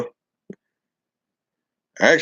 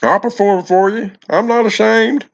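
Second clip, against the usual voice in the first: a hint of Donald Duck put on for the viewers; 12 semitones higher than usual. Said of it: "my Donald Duck voice".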